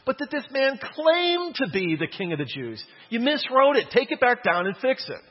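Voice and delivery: high, loud indignant objection; indignant protest. Retold or imitated. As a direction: protest hotly that it must be fixed.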